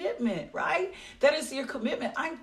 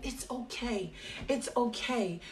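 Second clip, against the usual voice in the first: soft, much quieter comfort; gentle reassurance; 5 dB quieter, slower than usual. Real, not acted. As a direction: ease their guilt softly. Reassuring listeners who lapsed.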